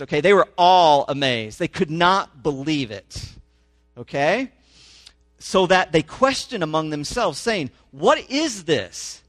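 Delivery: rising, emphatic public reading of the passage; scripture reading. Acted. Pastor reading Mark to his congregation, animated scripture reading. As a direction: read the gospel out to the congregation with growing force.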